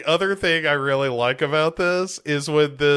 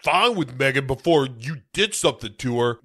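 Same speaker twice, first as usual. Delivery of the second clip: imitated petulant gripe, starting high; bitching kid; 4 semitones higher than usual. Child complaining to guardian about losing robot, mock bitching. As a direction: act out a sulky kid's complaint. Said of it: "Katie is bitching about having Megan".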